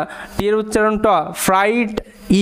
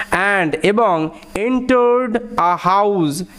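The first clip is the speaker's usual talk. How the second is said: "entered a house" drawn out word by word; slow pronunciation read; slow, clear read; much slower than usual. Shown as a demonstration. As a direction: read slowly so the "ou" in "house" is heard. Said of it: "ও ইউর উচ্চারণটা আউ টাইপের হচ্ছে".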